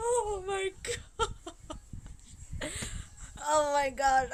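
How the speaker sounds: real tears breaking into the words; slow and high, fighting back tears; choked up. Real, not acted. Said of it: "I'm about to cry again".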